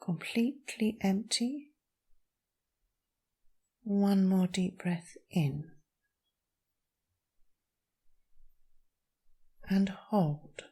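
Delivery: calm meditation voice with long pauses; guided meditation. Acted. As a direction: guide them gently through each breath, leaving space. Leads a breathing meditation.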